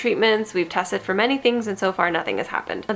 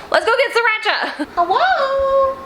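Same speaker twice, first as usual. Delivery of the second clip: high, bright squeal to the cats; 14 semitones higher, 3 dB louder than usual. Real, not acted. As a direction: coo at the kitties like babies. Greeting her cats in baby talk.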